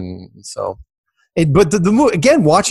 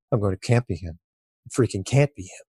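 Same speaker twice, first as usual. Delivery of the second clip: muttering to himself; 4 semitones lower, 6 dB quieter than usual. Retold or imitated. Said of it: "It's like".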